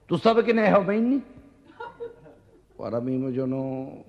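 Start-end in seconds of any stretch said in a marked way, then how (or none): 0.1-1.2 high teasing question with a laugh in it; mocking quiz question. Show-off friend quizzing the homebody with a high mocking laugh.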